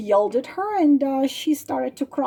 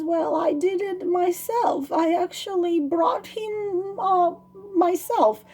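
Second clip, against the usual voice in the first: put-on higher-pitched, sheepish storybook girl; acted sheepish confession; 6 semitones higher than usual. Girl confesses to the bird that it's her doing, sheepish. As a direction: admit it sheepishly, voice lifted.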